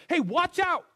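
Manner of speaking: sudden high loud shout of warning; yelled warning. Pretend. Stranger yelling a warning on the street. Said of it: "just yelled".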